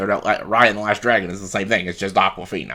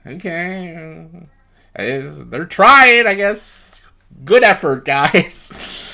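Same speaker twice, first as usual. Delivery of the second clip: higher, slow, patronizing praise; sarcastic encouragement; 8 semitones higher, 5 dB louder, much slower than usual. Retold or imitated. Reacting to the new songs, sarcastic higher patronizing praise. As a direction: pat them on the head, mockingly. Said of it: "I'm just like".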